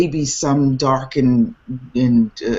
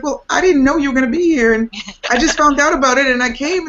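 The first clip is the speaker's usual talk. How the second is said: high, rushed retold reply; excited explanation; 12 semitones higher, 4 dB louder, faster than usual. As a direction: act out a friend explaining in a flurry. Voices friend's excited reply.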